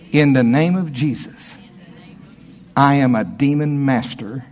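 ordinary delivery

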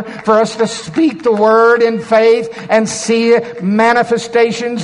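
high, building, full-force sermon delivery; impassioned preaching. Real, not acted. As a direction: rouse the congregation phrase by phrase.

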